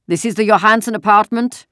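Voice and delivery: put-on loud, high German-accented soldier demanding at the door; loud, foreign-accented soldier voice. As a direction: bark it out like an occupying soldier at the door. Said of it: "a deep voice asked the question loudly in the terribly accented Danish".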